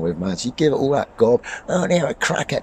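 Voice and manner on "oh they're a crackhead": mocking troll voice.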